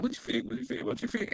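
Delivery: playfully imitating herself bugging Alex for his opinion; mimicking herself nagging. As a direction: pester a friend over and over. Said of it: "be like".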